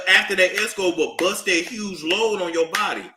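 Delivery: ordinary talk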